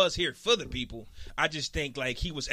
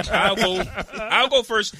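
laughing; 6 semitones higher, 6 dB louder than usual. Real, not acted. Laughing while volunteering.

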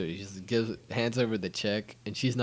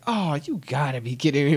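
high, loud mock dismay; acted indignation, pitched up; exasperated disbelief; 7 semitones higher, 8 dB louder than usual. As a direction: groan it out in hammed-up protest. Character refuses to give money away.

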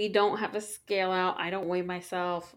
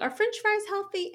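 put-on higher, curious little-kid question; imitating her daughter; 14 semitones higher than usual. Mom quoting her daughter asking about fries, lightly higher kid voice. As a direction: ask it up high like a small child checking. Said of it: "so she'll be like".

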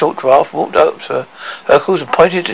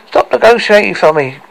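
higher protesting cry; 7 semitones higher, 4 dB louder than usual. Acted.

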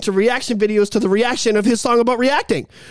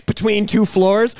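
ordinary delivery

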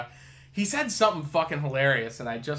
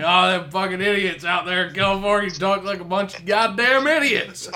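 mock yokel; 5 semitones higher, 6 dB louder than usual. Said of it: "assholes like us are like".

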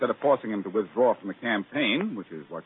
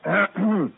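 awkward throat clearing; 11 semitones higher, 8 dB louder than usual. Acted. Awkward reaction to marriage hint.

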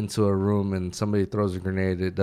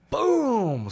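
smack sound; 4 dB louder than usual. Retold or imitated. Smacking the soldier awake.

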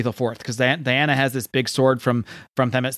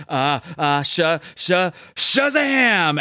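stammering into a shout; 5 semitones higher, much slower than usual. Acted. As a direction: stutter in fear, then burst out with the magic word. Panicking boy transforms into hero.

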